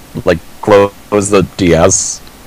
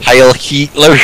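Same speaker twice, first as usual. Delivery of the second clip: higher, exaggerated German accent; mock German accent; 5 semitones higher, much slower than usual. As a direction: imitate a movie German on "Heil Hitler". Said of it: "That's how they said it in Captain America".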